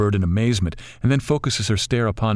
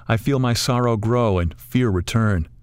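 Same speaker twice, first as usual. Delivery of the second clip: ordinary delivery, nothing marked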